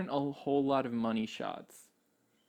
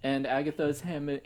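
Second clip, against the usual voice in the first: ordinary talk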